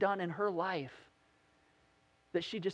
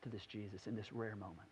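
quiet resolve; 8 semitones lower, 11 dB quieter than usual. Retold or imitated. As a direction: say it softly as if to yourself. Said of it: "she's like".

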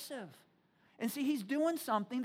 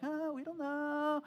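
slow, higher-pitched wishy-washy whine; mock noncommittal; 4 semitones higher, slower than usual. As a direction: mimic timid people dodging a question. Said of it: "somebody who's not brave".

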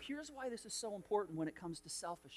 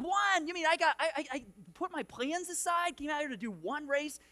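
mock outraged, higher and louder; indignant disbelief; 7 semitones higher, 5 dB louder than usual. Pastor retells protesting to buddy Dave about the single race, in a mock outraged voice. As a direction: act out the incredulous protest.

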